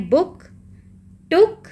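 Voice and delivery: बुक and टुक high, with brief oo; quick, punched बुक, टुक with short vowels. Shown as a demonstration. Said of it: "दोनों में ही short है".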